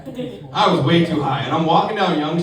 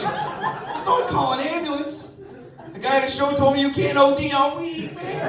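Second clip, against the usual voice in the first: panicky advice; 8 semitones higher than usual. Retold or imitated. Son advising dad on phone.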